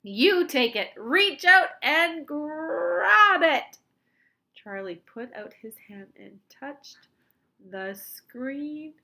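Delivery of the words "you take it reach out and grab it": loud urging, climbing and stretched at the end; cried out. A narrator's reading. Wonka urging Charlie to grab the bar, cried out. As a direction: build to a long, high, loud finish. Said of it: "cried Mister Wonka".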